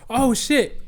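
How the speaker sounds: "Oh shit" jumped very high in pitch; a sudden yelp of alarm. Retold or imitated. Swinging out with the van door, alarmed high yelp. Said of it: "And I'm like".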